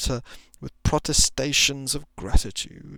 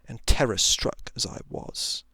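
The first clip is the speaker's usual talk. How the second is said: ordinary delivery, nothing marked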